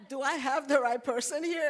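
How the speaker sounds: ordinary talk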